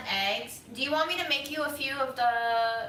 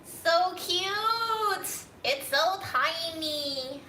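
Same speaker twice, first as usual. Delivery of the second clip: cooing; 6 semitones higher, much slower than usual. Real, not acted. Cooing over tiny thing.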